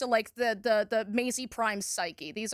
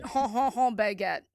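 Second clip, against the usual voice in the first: bad French accent. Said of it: "But the accent is very bad".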